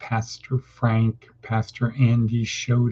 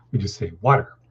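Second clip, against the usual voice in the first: ordinary delivery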